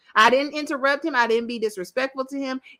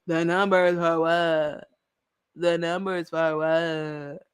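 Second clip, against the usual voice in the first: slow, lower mock of Quentin; mimicking Quentin; 7 semitones lower, slower than usual. Mimicking Quentin's reply to the podcast host, slow and lower. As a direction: draw it out like Quentin, slightly lower.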